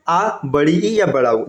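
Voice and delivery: बड़ी ई या बड़ा उ drawn out slowly, each word weighed; slow emphatic key phrase. Shown as a demonstration. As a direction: spell out बड़ी ई या बड़ा उ slowly as the trick to remember. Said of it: "ये इसका ट्रिक है". (बड़ी ई या बड़ा उ को धीरे-धीरे ज़ोर देकर बोलना)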